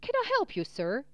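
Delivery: courteous coachman, starting high and dropping on "sir"; polite asking. A narrator's reading. Coachman turns to his passengers at the window, asking politely. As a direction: offer help politely, lifted in pitch. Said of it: "the coachman asked politely".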